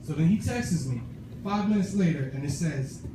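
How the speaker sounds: ordinary talk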